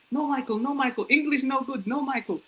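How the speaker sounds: very high, soft learner's English; broken beginner English. Retold or imitated. Newcomer refusing the name Michael, in broken beginner English. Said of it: "That was my English at the time".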